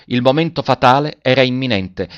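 usual speaking voice

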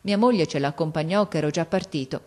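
ordinary delivery, nothing marked

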